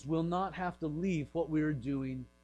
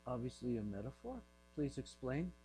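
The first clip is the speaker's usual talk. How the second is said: low, soft, tentative aside; lightly acted uneasy crowd, kept low; hushed puzzled listeners; 6 semitones lower, 10 dB quieter than usual. Puzzled followers asking Jesus to explain, hushed.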